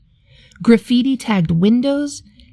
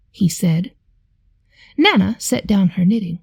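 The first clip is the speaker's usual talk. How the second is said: ordinary delivery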